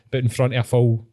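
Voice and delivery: usual speaking voice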